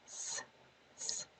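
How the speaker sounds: "th" as a soft voiceless hiss of air alone; isolated TH sound. Shown as a demonstration. Teacher demonstrates T H to learners, isolated voiceless "th". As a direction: breathe out a bare "th", tongue at the teeth. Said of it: "T H sounds like th".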